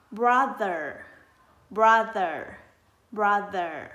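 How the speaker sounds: "brother" blended whole, loud with a falling end; ghép âm. Shown as a demonstration. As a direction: model "brother" clearly, pausing between repeats. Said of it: "bây giờ mình ghép lại mình sẽ có". (ghép lại đọc to "brother", xuống giọng cuối từ)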